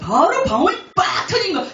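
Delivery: high, loud and thrilled; excited burst. Real, not acted. Telling the church how tongues finally came, excited. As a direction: blurt it out like a sudden breakthrough.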